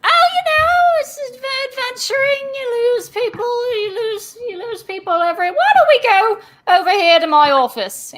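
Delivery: chirpy, evasive mall cop steering them to his office; Barnabas, dismissive. Acted. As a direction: wave off the deaths as part of the job. Mall cop brushing off body count.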